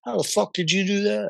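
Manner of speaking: lightly voiced New Jersey mobster; a hint of a gruff mob boss; Tony Soprano. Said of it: "Tony Soprano".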